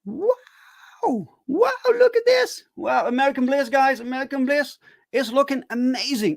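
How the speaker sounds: high-pitched wowing over artwork; thrilled show-and-tell; excited awe. Real, not acted. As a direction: gush in amazement, voice lifted high. Showing off new art, awed.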